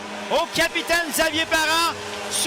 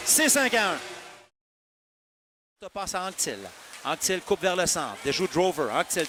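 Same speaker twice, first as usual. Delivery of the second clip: normal talking voice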